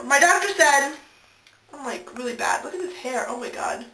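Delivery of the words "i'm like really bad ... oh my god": softly muttered self-conscious aside; quiet embarrassed aside. Real, not acted. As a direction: mumble it, embarrassed. Embarrassed aside about hair.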